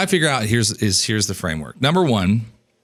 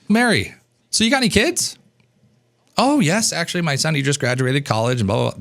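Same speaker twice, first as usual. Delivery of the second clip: chatty small talk; 5 semitones higher than usual. Shown as a demonstration. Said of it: "acted host and guest exchange".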